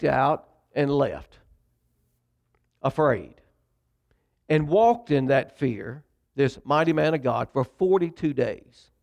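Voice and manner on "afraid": ordinary talk, nothing marked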